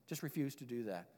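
ordinary talk, nothing marked

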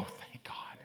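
breathy whispered relief; grateful whisper. Retold or imitated. Pastor showing his own relieved response, whispered. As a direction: hush it like a private thank-you. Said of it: "I read that statement and go".

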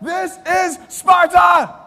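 huge, high drawn-out battle cry; yelled movie catchphrase. Shown as a demonstration. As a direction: bellow it out slowly, word by word, as a big joke punchline. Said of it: "then I yell out".

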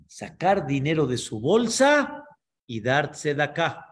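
ordinary talk

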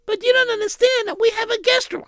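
gentle wife voice. Acted. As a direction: ask politely in a high, hesitant voice. Wife voice pleading.

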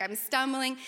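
ordinary delivery

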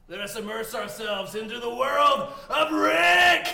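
loud, slow dramatic proclamation; grand announcement. Acted. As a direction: boom it out like a showman. Grand dungeon opening.

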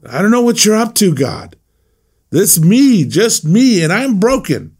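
high, fast, raw plea to God; pleading lament. Retold or imitated. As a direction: pour out the complaint to God. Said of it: "Basically what he's saying".

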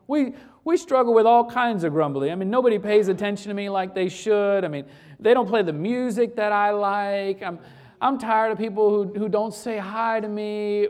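loud, pressing complaints; mock grumbling rant. Preacher voicing church members' grumbles, mock rant. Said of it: "preacher voicing grumblers at full force".

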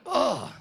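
high, loud open groan; acting out a heaving groan. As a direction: let out a hammed-up pained groan. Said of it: "groaning, like".

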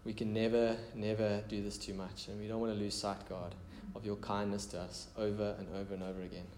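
quiet, intimate prayer leading. Real, not acted. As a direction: pray aloud gently and earnestly, voice dropping at phrase ends. Leads the congregation in prayer.

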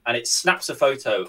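ordinary talk

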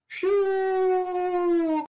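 high whooshing airplane sound; a long, swooping mock plane whoosh; airplane noise. Speaker doing a requested airplane noise, high drawn-out whoosh. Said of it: "make airplane noises".

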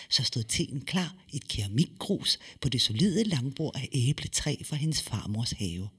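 plain reading voice

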